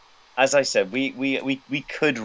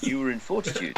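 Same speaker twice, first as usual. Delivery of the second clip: lower voice; 6 dB quieter than usual. Shown as a demonstration. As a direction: lower and softer, deliberate. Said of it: "I'll say that in a lower voice".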